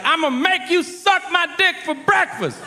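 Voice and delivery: high, mocking tough-guy threat; menacing inmate voice. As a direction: play the prison bully high and goofy. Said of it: "He's like".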